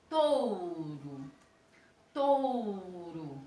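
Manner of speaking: touro with the OU open and strong; demo word touro. Shown as a demonstration. Teacher saying touro for students to hear. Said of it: "o meu som bem aberto, bem forte".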